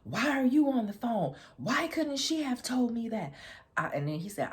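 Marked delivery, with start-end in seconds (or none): 0.0-3.3 high, loud, mock yelled outrage; screaming. Ex screaming at the friend over the phone. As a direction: yell it far higher and louder, offended. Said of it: "you could hear him screaming".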